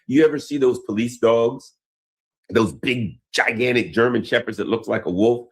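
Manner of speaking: ordinary talk, nothing marked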